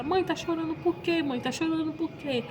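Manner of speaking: put-on high, childlike worried voice; daughter Sara's question. Little daughter asking her crying mother why, high childlike voice. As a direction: ask it like a little girl worried about her mom. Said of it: "E Sara".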